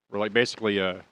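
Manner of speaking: normal talking voice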